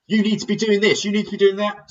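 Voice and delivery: high, loud and pushy; bossy loud drill voice. Shown as a demonstration. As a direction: bark the orders like a drill instructor. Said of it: "if your tone is like this".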